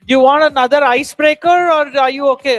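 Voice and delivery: loud call to the whole hall. Real, not acted. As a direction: project it across the room. Facilitator calls out to audience.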